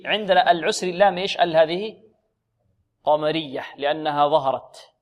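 ordinary delivery

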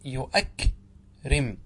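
يؤكرم very slowly, hamza sounded and a long break before رم; original form with hamza. Shown as a demonstration. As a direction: stretch out يؤكرم as yu'ak, pause, rim. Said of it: "هكذا الأصل".

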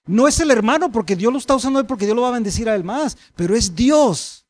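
emphatic pulpit delivery; forceful preaching. Real, not acted.

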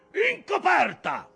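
high, loud, drawn-out shout; furious order. A narrator's reading. Ahab cuts off his officer's criticism, furious shout. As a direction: bellow it, stretching the words.

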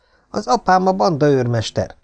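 chest-out pride in his father; proud boast. Acted. Big boy bragging to the newcomer about his father, proudly. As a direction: say it bragging a little. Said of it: "tette hozzá nagy büszkén".

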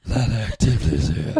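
very low laughing man voice; deep voice breaking into laughs. Acted. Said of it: "sounding like Carl".

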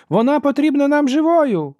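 very high, sustained loud yell; woman shouting. A narrator's reading. Sister warns soldier not to kill, shouting. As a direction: scream the reminder over the fight. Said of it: "закричала жінка".

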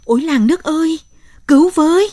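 high, loud desperate scream to the village; screaming for help. A narrator's reading. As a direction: cry out to the whole village in panic. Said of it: "Bà thét lên".

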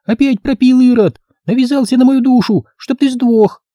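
child playing the nagging wife; ringing little-girl voice. Girl playing wife demands money, high ringing child voice. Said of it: "звенел девчоночий голосок".

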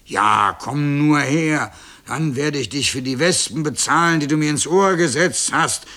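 loud bellowed threat; shouting cow. A narrator's reading. Cow Gulia avenging the wasps, shouting. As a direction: call him over for payback, forcefully. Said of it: "schrie Gulia".

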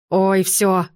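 ordinary delivery

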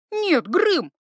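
sharp high cry of alarm. Acted. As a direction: stop him with a sudden high shout. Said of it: "вскрикнула она".

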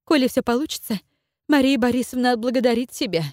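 high, ingratiating promise; a touch of fawning flattery; slightly fawning. Acted. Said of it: "чуть заискивающе пообещала она".

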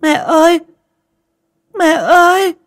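put-on very high, loud child's wail; a little boy shrieking for his mother; crying child calling after his dead mother; screaming, crying child. A narrator's reading. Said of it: "khóc thét lên".

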